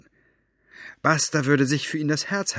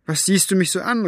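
high, loud defensive outburst; exclaiming; 9 semitones higher, 5 dB louder than usual. A narrator's reading. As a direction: cry out, caught out and defensive. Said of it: "rief er".

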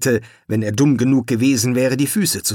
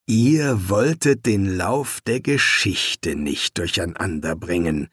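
slowly repeating each word, deadpan; 4 semitones lower than usual. Acted. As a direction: echo it back slowly, dry. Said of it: "wiederholte Laveolus langsam".

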